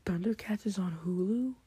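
ordinary talk

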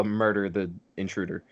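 ordinary talk

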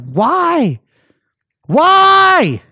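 high, drawn-out anguished shout; yelling at God. Retold or imitated. Drunk man alone outside, yelling at a God he doubts. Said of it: "I'm yelling, like, at God".

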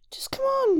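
high-pitched giant voice; coaxing giant. A narrator's reading. Giant calling the shoemaker to bed, high and coaxing. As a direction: coax in a high character voice.

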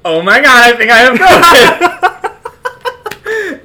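high, loud hammed-up panic with a laugh; mock panic. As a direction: squeal it like a panicking patient. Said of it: "I'm like".

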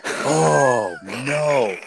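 loud, high, drawn-out dismay; mock dismay. Man realizes he soiled his pants, hammed-up dismay. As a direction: wail it out in exaggerated horror. Retold dismay at soiling himself.

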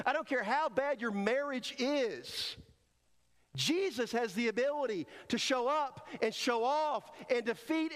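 high, impassioned preaching; preaching at full force. Real, not acted. Preacher assures the church Jesus calms any storm, high and fervent. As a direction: build it to a climax.

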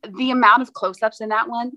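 usual speaking voice

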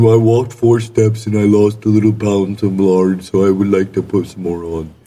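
lard-craving glutton voice; silly lard lover. Pretend. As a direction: drool over lard in a deep goofy voice. Continuing the lard voice.

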